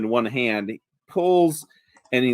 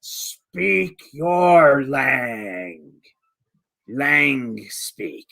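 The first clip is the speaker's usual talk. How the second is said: giantess broken speech; much slower than usual. Acted. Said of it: "she says".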